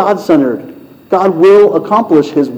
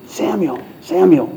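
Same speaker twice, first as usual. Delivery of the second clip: soft calling voice; 6 dB quieter than usual. Acted. God's voice calling in the night.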